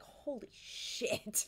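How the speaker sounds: drawn-out whispered holy shit; slow whispered curse. Retold or imitated. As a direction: breathe it out slowly in shock. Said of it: "did you bump it and you were like".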